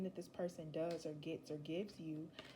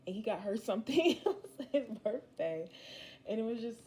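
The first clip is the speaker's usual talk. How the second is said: laughing; 4 semitones higher, 8 dB louder than usual. Real, not acted. Laughing at cute birthday story.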